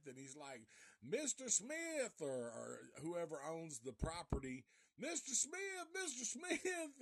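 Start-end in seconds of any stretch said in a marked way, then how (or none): 1.0-2.2 high, slow desperate calling; scared to death. Retold or imitated. Hazel Rigg chased by the tractor, high slow desperate calling. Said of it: "he's scared to death". 5.0-7.0 much higher, desperate pleading calls; scared to death. Retold or imitated. Hazel Rigg chased by the tractor, high terrified calling. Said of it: "he's scared to death".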